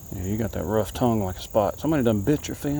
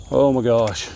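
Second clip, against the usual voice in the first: ordinary delivery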